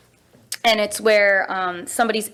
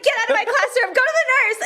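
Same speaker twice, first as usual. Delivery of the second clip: panicked shout; faster than usual. Retold or imitated. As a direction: shoo the kid out in panic. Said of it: "I was like".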